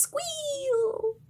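long, very high mock squeal; high-pitched excited squeal, imitating a character; imitated squeal. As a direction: squeal the word itself, stretched thin. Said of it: "old man Cunnilingus squeals. He goes".

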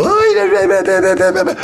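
put-on high cooing baby-talk voice. Shown as a demonstration. Speaker shows how we coo at a baby, in baby talk. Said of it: "If you're talking to a baby".

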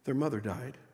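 barely above a murmur, lost for words after a death; grieving quiet apology. Acted.

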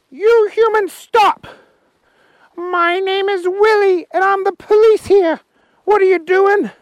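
high, loud little policeman voice; Willie the policeman. Acted. Policeman Willie stopping the humans, high and loud. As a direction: bark orders as a tiny policeman. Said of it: "he said".

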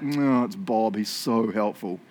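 low, slowing drawl; mock admiring remark. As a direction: say it as a drawn-out aside about a colleague. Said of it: "everyone's like".

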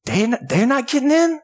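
high-pitched disbelief; imitating a baffled bystander, pitched well up; incredulous crowd member. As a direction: protest as a stunned listener who can't believe the bar. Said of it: "average person that's sitting in this crowd".

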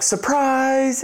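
high group cheer of surprise; spring it on her, high and bright; mock family yelling surprise; excited shout.

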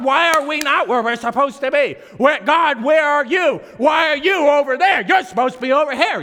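high, loud, exasperated questioning; indignant complaint to God. Acted. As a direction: demand answers of God, mock outrage.

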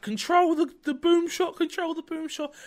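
high, loud imitation of yelling into a headset; retold scream. Gamer retelling yells into Xbox mic, screaming. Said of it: "and I'm screaming".

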